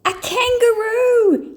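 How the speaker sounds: high, loud alarmed cry; startled yell. A narrator's reading. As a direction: shout in alarm at the intruder. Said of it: "yelled the dancers".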